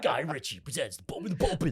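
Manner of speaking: mock movie announcer with British accent; hammed-up trailer voice; British gangster film trailer. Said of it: "Sounds like a British gangster movie title".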